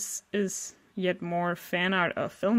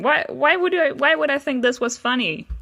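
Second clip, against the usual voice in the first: higher, louder incredulous questioning; baffled disbelief; 6 semitones higher, 5 dB louder than usual. Real, not acted. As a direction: demand an explanation from your past self. Baffled at own old joke.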